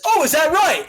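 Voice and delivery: loud, high challenge; wrestler's retort. Acted. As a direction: pipe up sharply as the eavesdropping rival. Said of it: "And then Taz says".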